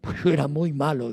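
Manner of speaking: a hint of a Spanish accent, confessing. [name] confessing his conversion in a mild Spanish accent. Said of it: "Bien con su acento español".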